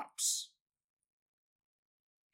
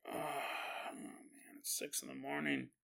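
groggy mumble; 3 semitones lower, 17 dB quieter than usual. Retold or imitated. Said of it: "and Ray's like".

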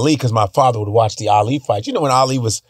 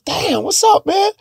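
much higher, excited hail; starstruck greeting; slower than usual. Retold or imitated. Fan greeting [name] in the hallway, high and excited. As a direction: greet a star, thrilled. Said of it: "I was like".

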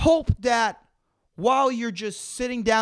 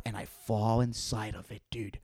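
whispering; 10 semitones lower, 9 dB quieter than usual. Acted. Fantasizing death, drops voice.

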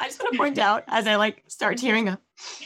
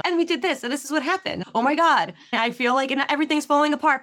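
higher, mock-panicked rush; frantic client quote; 6 semitones higher than usual. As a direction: play the overwhelmed client, half-joking. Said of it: "coming and saying".

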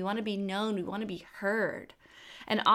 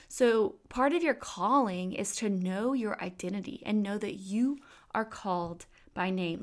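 ordinary delivery

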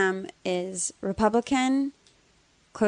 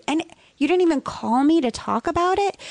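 indignant question; 9 semitones higher than usual. Retold or imitated. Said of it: "I said".

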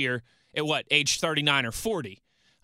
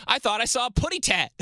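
high, chuckling cartoon-bird quote; playful high-pitched little cartoon voice; 9 semitones higher than usual. Host reading out a fan's tweet, cartoon bird voice.

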